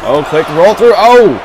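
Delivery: loud, high sudden call; excited shout. Real, not acted. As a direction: call out the big slam. Reacting to fast move.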